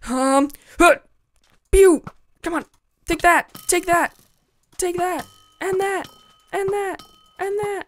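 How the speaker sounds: high-pitched mock excitement, slowing; squeaky playful imitation of himself fighting; mock battle cries. Gamer imitating his own squeaky voice mid-fight. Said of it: "I'm like".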